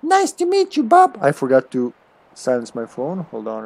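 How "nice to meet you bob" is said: very high squeaky creature greeting. Acted. Creature meeting its new master, squeaky voice. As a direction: chirp it in a tiny falsetto. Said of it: "You gotta give it a squeaky voice".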